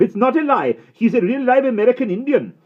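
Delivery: high, loud, on the edge of tears; desperate shout. A narrator's reading. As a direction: insist with all his force. Patrick shouts, near tears.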